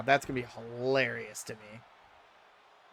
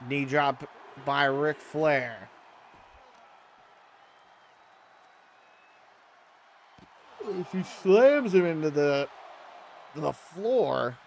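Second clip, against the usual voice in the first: wrestling commentator; 5 semitones higher, slower than usual. Acted. Live wrestling game play-by-play.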